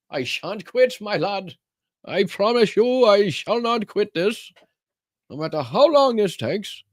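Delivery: put-on high, grand old-fashioned gentleman swearing an oath; hammed-up high gallant pledge; mock noble vow. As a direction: play a stiff storybook lord promising his lad.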